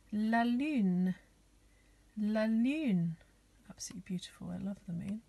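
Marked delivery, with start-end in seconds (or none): 0.1-3.2 "la lune" said in French with ü; French pronunciation. Shown as a demonstration. Teacher to children learning the moon in French, "la lune" pronounced with ü. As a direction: model "la lune", drawing out the ü. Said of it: "which is called".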